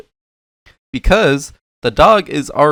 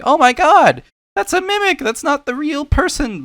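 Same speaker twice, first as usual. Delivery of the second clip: very high mock-alarmed voice, loud at the start; mock panicked video maker; 3 dB louder than usual. YouTuber imitating whoever made the mimic video, mock panic. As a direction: squeal it in exaggerated, put-on panic. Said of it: "the person's mind who made the video was like".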